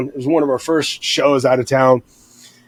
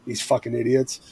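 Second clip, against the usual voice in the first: mock disdainful undertone, lower and softer; contemptuous aside; 4 semitones lower, 9 dB quieter than usual. Bartender eyeing the drinking band, muttered disdain. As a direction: lightly imitate a scornful aside, kept low and quiet.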